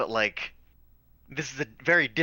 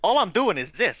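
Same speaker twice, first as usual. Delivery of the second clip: sketchy goblin with a New York accent; loud, higher New York goblin voice; vague New York accent; 8 semitones higher, 5 dB louder than usual. Shown as a demonstration. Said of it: "the vague New York accent 'cause I'm playing a goblin".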